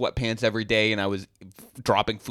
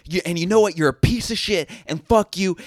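higher, louder mock outburst that flips into a sorry; mock rage then apology; 6 semitones higher, 5 dB louder than usual. A mock furious rant that turns into a hasty apology. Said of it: "I shouldn't be speaking this way".

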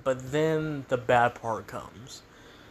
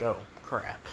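low, much softer oh crap under the breath; muttered dismay; 4 semitones lower, 7 dB quieter than usual. Retold or imitated. Parent caught by a child's question mutters, low and quiet. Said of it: "you're gonna be like".

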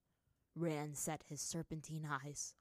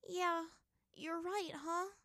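resigned; 11 semitones higher, slower than usual. A narrator's reading. Ty Lee resigned to die.

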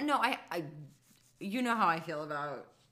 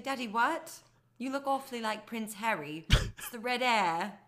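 higher, hammed-up British child voice; mock British accent; 5 semitones higher than usual. Child answering dad's news, in mock British accent. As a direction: play a child answering Daddy in British English. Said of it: "why are you talking in a British accent".

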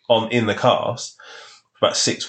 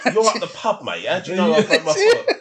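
laughing protest; 9 semitones higher than usual. Retold or imitated. Arguing with dad, laughing.

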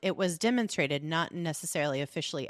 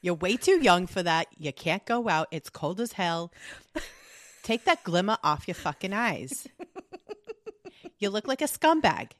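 mock Boston parent laying down the law, louder; hammed-up parent scolding a teen, louder; 3 semitones higher, 4 dB louder than usual. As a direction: rant like a fed-up Boston mom. Scolding parent voice continues bit.

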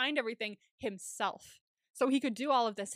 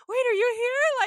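high, loud sudden hope; Day realizing his friend is outside, thrilled high outburst; excited gasp; 11 semitones higher, 11 dB louder, slower than usual. Retold or imitated. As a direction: burst out with delighted disbelief. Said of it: "he went".

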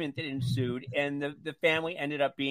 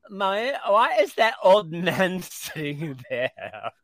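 mock little-boy whine, slow and louder; 2 semitones higher, 6 dB louder, slower than usual. Little boy asking his mother on the plane, mock childish voice. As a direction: draw it out like a loud little kid. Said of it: "the little boy says".

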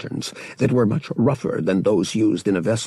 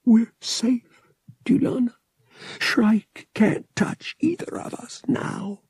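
high, cracked whisper near tears; speaking to dead Dulana, voice breaking; 13 semitones higher, slower than usual. A narrator's reading. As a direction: let the voice break as you whisper. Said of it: "His voice broke".

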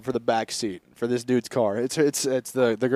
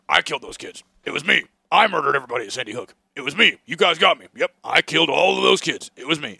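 Alex Jones impression; 4 dB louder than usual. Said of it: "Alex Jones being like".

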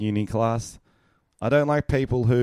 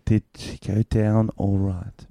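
slow, sultry wife line; sultry line reading; slower than usual. Shown as a demonstration. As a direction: make the line sound suggestive. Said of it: "You made it sound sexual".

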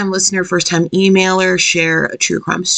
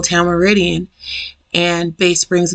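ordinary delivery

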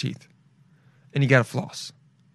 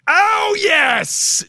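triumphant shout. Real, not acted. Host remembering the word at last, triumphant shout. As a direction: yell it out like you just remembered.

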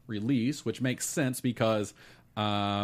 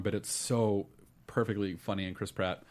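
ordinary talk

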